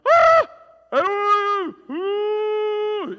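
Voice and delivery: high loud panicked yelling; teen driver screaming at merging car; panicked screams. Retold or imitated. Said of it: "he just started going".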